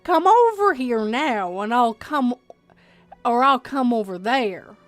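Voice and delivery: aggressive. Acted. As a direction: order them over forcefully, with a Southern accent. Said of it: "hear the aggression in his tone".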